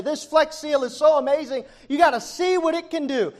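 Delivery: high-pitched, eager sales pitch; excited infomercial pitchman. Retold or imitated. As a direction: imitate a TV pitchman sold on the product. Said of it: "He's excited".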